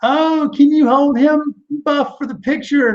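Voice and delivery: very high, slow, sugary mother asking a favour; new mother sweetly asks the wrestler to hold her baby; high sweet lady voice. Retold or imitated. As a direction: coo it like a proud new mom. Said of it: "she's like".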